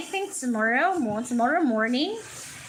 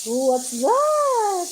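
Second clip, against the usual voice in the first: surprised; 5 semitones higher, 4 dB louder, slower than usual. Real, not acted. Spots something outside.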